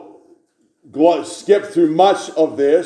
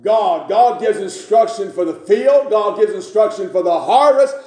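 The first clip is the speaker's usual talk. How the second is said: higher, fervent preaching voice; 5 semitones higher than usual. Acted. Preacher proclaiming God's word to congregation, fervent preaching. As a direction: preach it out with conviction.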